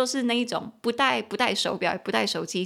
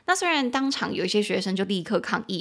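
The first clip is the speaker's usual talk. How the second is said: ordinary talk, nothing marked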